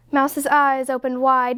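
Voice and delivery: ordinary delivery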